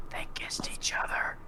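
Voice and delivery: hushed aside, whispered behind a hand. Acted. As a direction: whisper it like a secret. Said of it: "I'm gonna whisper".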